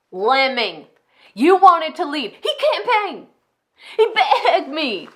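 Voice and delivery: high, loud accusing rant; unhinged scorn. Acted. Enid berates her failing executive pastor, unhinged scorn. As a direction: fling it at him, pitch leaping up. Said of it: "how insane they can sound at times".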